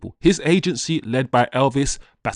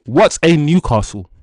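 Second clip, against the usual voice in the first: louder, scornful pretend quote; mock dismissive quote; 3 semitones higher, 7 dB louder than usual. Fan voicing the new striker mocking rival club, scornful. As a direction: toss it off as if Newcastle were nothing. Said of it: "No, no, he didn't say".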